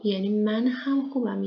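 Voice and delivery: usual speaking voice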